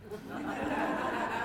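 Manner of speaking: a short laugh at the memory; amused laugh. Real, not acted. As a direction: grandfather laughing at his granddaughter's answer. Laughs at granddaughter's reply.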